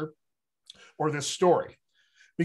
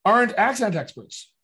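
"aren't accent experts" higher and louder; emphatic repeat; 6 semitones higher, 5 dB louder than usual. Real, not acted.

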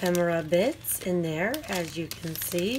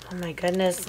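ordinary talk

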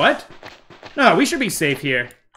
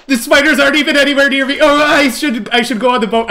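high, rushed alarm; flustered, looking for escape; panicked; 6 semitones higher than usual. Real, not acted. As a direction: blurt it out in fright. Panics over spiders, wants the boat.

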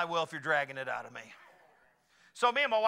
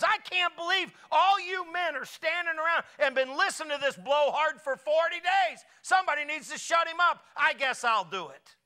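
higher, louder indignant shout; David shouting down the blowhard; 9 semitones higher, 4 dB louder than usual. Retold or imitated. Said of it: "He goes".

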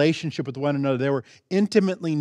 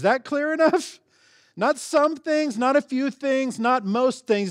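rising emphatic insistence; 9 semitones higher than usual. Acted. Preacher hammering home a point.

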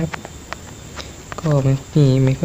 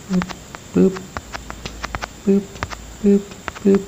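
little mouth-made pop sounds, last ones higher; playful pop noises; 6 semitones higher, 4 dB quieter than usual. Pretend. Player voicing his own block placing, playful pops. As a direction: pop pop along with each click.

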